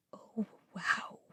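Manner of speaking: hushed, voiceless oh wow; awed whisper. A narrator's reading. Gates seeing Mom plug into Dad, awed whisper. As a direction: breathe it in awe without voice. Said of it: "Gates murmured behind me".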